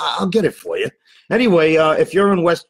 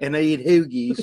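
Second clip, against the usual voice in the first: South Philly accent; 2 semitones higher than usual. Shown as a demonstration. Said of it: "get my South Philly accent on".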